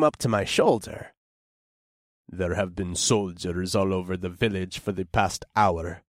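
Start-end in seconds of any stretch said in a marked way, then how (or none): 2.3-6.0 lower, softer gruff orc grunt. A narrator's reading. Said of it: "the orc grunted".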